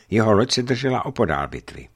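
ordinary delivery

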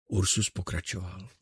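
ordinary delivery, nothing marked

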